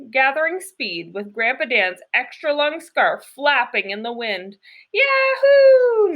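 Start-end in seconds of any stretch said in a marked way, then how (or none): 4.9-6.2 high, loud joyful whoop; excited yell. A narrator's reading. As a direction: holler with glee at full speed. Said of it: "Nikki yelled again".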